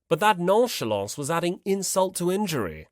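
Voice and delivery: ordinary delivery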